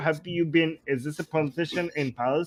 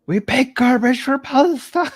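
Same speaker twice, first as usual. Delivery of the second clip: mock slogan; 5 semitones higher, 5 dB louder than usual.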